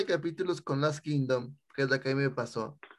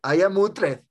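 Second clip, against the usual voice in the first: German accent impression; 2 semitones higher, 5 dB louder than usual. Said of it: "no tiene la voz tan grave".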